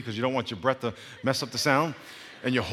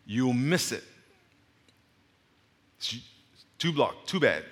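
ordinary delivery

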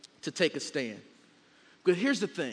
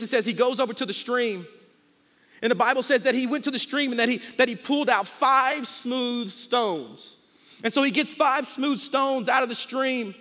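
high, loud, driving sermon delivery; rousing pulpit storytelling with acted dialogue; full-force preaching; 8 semitones higher, 7 dB louder than usual. Real, not acted. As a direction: preach the battle story to a whole room.

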